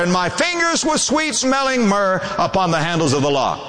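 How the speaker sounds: high, ringing preacher's voice; preaching at full force. Acted. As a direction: lift the sermon to its peak. Preacher builds to high pitch.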